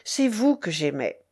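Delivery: plain reading voice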